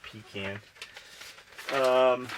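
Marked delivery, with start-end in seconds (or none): 0.0-0.6 pecan as pee-CAN, rhyming with "can"; mocked pronunciation. Shown as a demonstration. Scoffing at whoever says it, pecan as pee-CAN. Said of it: "Who the fuck says pecan".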